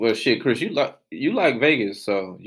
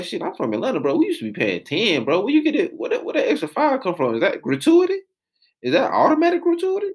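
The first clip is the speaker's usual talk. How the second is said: mock outrage; 9 semitones higher than usual. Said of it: "I was like".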